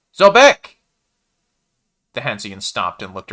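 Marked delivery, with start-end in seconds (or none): 0.1-0.8 loud high shout. A narrator's reading. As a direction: call out across the sand. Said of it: "cleared his throat and shouted it".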